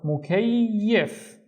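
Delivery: "mukayyif" drawn out as one word; slow, stretched "mukayyif"; syllables joined slowly. Shown as a demonstration. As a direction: link mu, kay and yif slowly without stopping. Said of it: "read them one syllable at a time".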